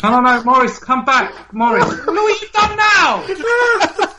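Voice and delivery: high, loud laughing plea; mock pleading. Host pleading with upset Maurice to come back, laughing. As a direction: beg him back while cracking up. Host begs Morris to return.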